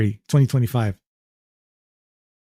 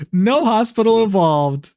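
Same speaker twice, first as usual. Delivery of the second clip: sarcastic; 7 semitones higher than usual. Acted. Mocking the hospital excuse.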